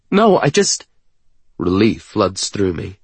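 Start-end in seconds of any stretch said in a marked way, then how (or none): 0.1-0.9 high, loud, broken-off protest; panicked. A narrator's reading. Woman hurrying to reassure her partner, panicked. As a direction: start to explain urgently and stall. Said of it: "Panic suddenly as she understands".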